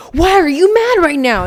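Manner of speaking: imitating her husband matching her anger; mock angry husband. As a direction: catch the mood and snap it back. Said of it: "Then he goes".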